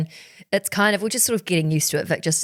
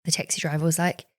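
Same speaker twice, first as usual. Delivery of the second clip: ordinary talk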